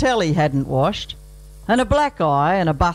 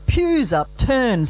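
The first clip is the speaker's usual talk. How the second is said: ordinary talk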